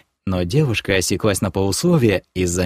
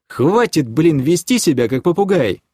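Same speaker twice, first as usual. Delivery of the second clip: exasperated scolding, raised pitch; angry; 6 semitones higher than usual. A narrator's reading. Man scolding his parroting girlfriend, angry. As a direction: tell her off in fed-up irritation. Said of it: "разозлился я".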